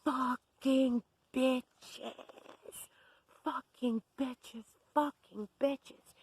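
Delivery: high, slow, drawn-out scream; imitated screaming. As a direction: scream each word out in mimicked rage. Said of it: "screaming at the top of her lungs".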